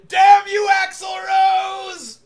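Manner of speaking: high, loud hammed-up cry to the heavens; mock dramatic curse. Frustrated the grow won't speed up, a hammed-up dramatic curse. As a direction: shake a fist and bellow it theatrically.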